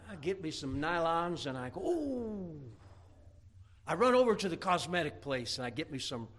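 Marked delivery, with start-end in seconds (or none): 1.8-2.6 high, put-on cooing "oh"; mock delight. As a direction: coo in pretend delight. Acts out putting on nylons.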